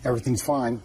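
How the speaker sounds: normal talking voice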